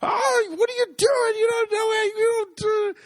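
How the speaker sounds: very high, loud mock shouting; hammed-up high-pitched yell; retold yelling. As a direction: imitate an irate farmer hollering. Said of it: "just yelling at me".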